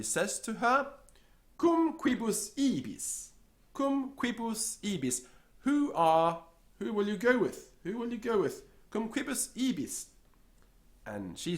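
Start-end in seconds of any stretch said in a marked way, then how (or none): 1.6-10.1 high, insistent asking; high repeated question. Acted. Somebody pressing the secretary, high and insistent. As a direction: press her for an answer.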